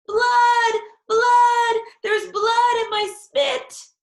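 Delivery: very high, very loud screeching; hollering. A narrator's reading. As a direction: scream it in pure panic. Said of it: "I hollered some more".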